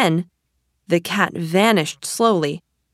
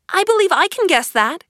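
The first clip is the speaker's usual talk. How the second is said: put-on high, louder girl character; Alice aloud; 13 semitones higher, 6 dB louder than usual. A narrator's reading. Alice offering to solve the riddle, high and loud. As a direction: speak up eagerly in a high voice. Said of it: "she added aloud".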